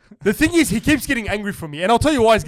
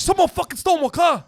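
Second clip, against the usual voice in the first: angry gangbanger; 8 semitones higher than usual. Retold or imitated. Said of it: "like, pissed".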